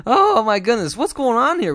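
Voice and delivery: baffled at the bad luck; oh my goodness, half-shouted; loud disbelief. Real, not acted.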